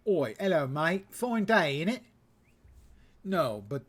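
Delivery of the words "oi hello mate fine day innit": put-on over-the-top British accent joke; mock British accent. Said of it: "Did you expect him going full".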